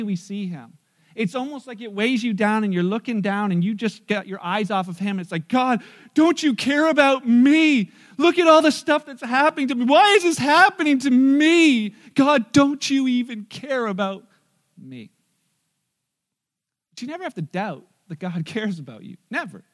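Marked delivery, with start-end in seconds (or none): 5.5-15.1 loud, high, drawn-out lament; aggrieved complaint to God. Acted. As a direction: wail it in self-pity, word by slow word. Voicing an overwhelmed, complaining believer.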